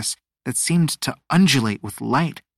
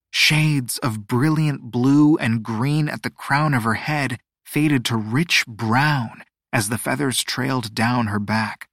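usual speaking voice

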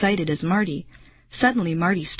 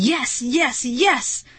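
higher-pitched triumphant cheer; excited cheering; 7 semitones higher, slower than usual. A narrator's reading. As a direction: cheer like a thrilled boy. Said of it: "Marty kept leaping into the air".

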